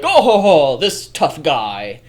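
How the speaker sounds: loud bragging tough-guy laugh; hammed-up tough guy boast; mock tough guy.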